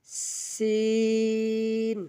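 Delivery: সিন with long hissed s, long vowel; airy drawn-out sin. Shown as a demonstration. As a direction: let air out lightly, then stretch সিন. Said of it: "আগে হালকা করে বাতাস বের করে". (হালকা বাতাস ছেড়ে টেনে সিন)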